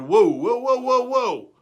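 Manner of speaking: high, loud mock-scolding whoa; scolding mom. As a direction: stop your son short, half amused.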